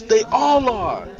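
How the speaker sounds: high, loud, slow statement; high slow blunt. Acted. Sammy tells Keith they all are. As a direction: lay it down flatly.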